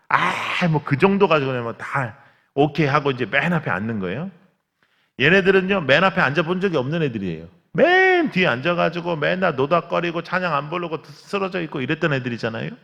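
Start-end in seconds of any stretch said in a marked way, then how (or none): 5.2-12.7 loud, raised preacher voice; fervent preaching. Acted. Pastor preaching to the congregation about the boys, fervent. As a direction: build it up from the pulpit.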